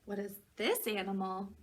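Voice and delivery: plain reading voice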